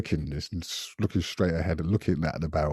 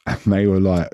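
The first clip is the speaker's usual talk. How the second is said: usual speaking voice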